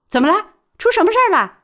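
sharp high urgent questions; alarmed concern. Acted. Baoli rushing in, asking the cornered He sao, alarmed and high. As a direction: burst in asking what happened.

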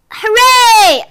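high, loud cheer; cheering cry. A narrator's reading. Everyone cheers the climber on, high excited cry. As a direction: cry out in celebration. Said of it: "everyone cried".

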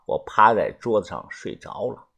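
usual speaking voice

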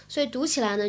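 normal talking voice

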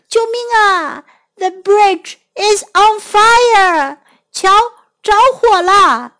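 high, loud alarmed cry; panicked shout. Acted. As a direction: yell it out in panic. Dog panics on burning bridge.

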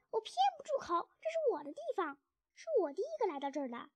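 high, loud, indignant squeal; defiant rat. A narrator's reading. Rat refuses to be silenced, shrilly defiant. Rat defies order to shut up.

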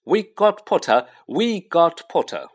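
Weasley twins cheering across the hall; twins yell. Acted. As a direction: yell it in triumph. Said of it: "the Weasley twins yelled".

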